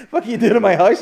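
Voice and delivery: high, loud laughing quote. Retold or imitated. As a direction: laugh out the man's angry question. Quoting the woken man.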